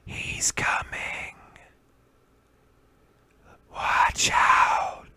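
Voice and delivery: slow, breathy ghostly whisper with a sudden loud hiss on the second bit; game whispers. Retold or imitated. Ghost voices in the headphones warning the player, whispered. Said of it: "you'd hear whispers like".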